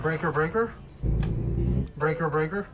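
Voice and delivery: trucker CB radio call; over cb radio. Pretend. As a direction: hail them like a CB trucker.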